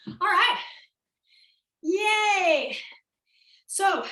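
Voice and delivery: loud, high, bright cheer of relief; excited cheer. Real, not acted. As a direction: whoop it up for the class. Instructor cheering after a break.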